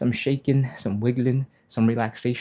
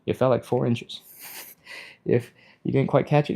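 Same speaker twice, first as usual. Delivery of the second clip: normal talking voice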